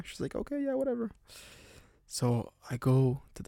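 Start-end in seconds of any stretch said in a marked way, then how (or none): 0.3-1.1 put-on much higher, shrugging old lady voice; dismissive old lady. Landlady brushing off the confused guest, high and dismissive.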